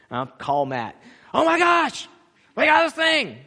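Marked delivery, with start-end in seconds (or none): 1.3-3.4 higher, louder mock alarm; mock panic. Pastor imitating himself calling Matt, mock panic. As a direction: play up your own panic for laughs. Retelling his own panicked call.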